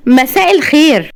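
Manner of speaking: high, merry greeting; cheerful. A narrator's reading. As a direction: greet them brightly, voice lifted high. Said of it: "وقالت بمرح ملحوظ". (بمرح ملحوظ)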